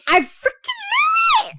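high, slow squeal of delight; a re-enacted shriek of triumph; excited squeal. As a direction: squeal it like you just guessed right. Retelling her reaction to news.

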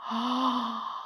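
long startled intake of breath; acted gasp. A narrator's reading. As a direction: gasp in alarm. Narrator gasps as the water arrives.

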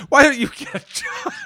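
high, loud, rapid jab; mock scolding yell. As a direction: fire it off as an exasperated parent. Mock parent scolding injured kid.

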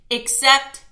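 plain reading voice

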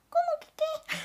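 very high, squeaky question; retelling her own squeaky reply to her husband. Retold or imitated. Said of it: "Así, ¿no?".

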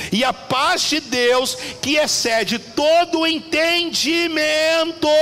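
slow, high, rising to a shout; preaching. Acted. Preacher proclaiming the verse to the congregation, building to a shout. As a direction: build the verse to a triumphant cry. Preaching scripture at full force.

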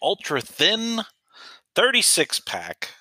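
slow, high salesman pitch; drawn-out high infomercial voice. Pretend. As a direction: sell it like a late-night ad. Reading product like an ad.

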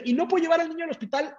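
lightly voiced wife, high and quick; imitated anxious wife. Voices wife's anxious phone questions.

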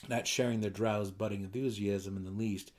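ordinary delivery, nothing marked

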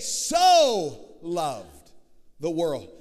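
leading the congregation, stress on So; emphatic call. Shown as a demonstration. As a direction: shout So for the crowd to echo. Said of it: "say it".

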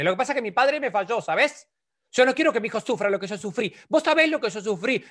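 high, whining self-pity; self-pitying father. Retold or imitated. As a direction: complain like a wounded grown son. Padre que se queja de su padre. (padre quejoso, agudo y lastimero)

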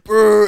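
loud mock retch; retching noise. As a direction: imitate puking with a loud gag. Imitating puking again.

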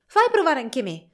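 esclamazione più alta e più forte, di nuovo con foga. A narrator's reading. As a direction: reclama il giocattolo con impeto. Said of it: "esclamò Fritz". (Fritz vuole provare lo schiaccianoci, esclama forte)

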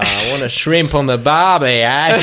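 put-on Aussie catchphrase voice; mock Australian accent. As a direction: offer it in hammy Australian. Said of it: "I love Australian accents".

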